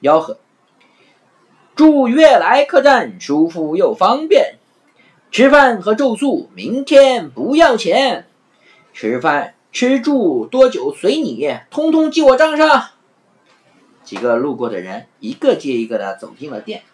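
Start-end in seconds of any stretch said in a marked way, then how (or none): 1.8-12.9 loud, slow, high hawker's call; street hawking. Acted. Afanti hawking at the inn sign, loud slow calling. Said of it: "大声的吆喝".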